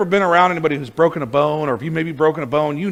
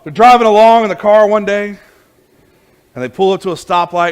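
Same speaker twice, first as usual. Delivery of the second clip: loud, higher, energetic telling; animated sermon storytelling; 3 semitones higher, 9 dB louder than usual. Acted. Preacher telling his congregation the farmer story, loud and animated. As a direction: sell the old truck story with gusto.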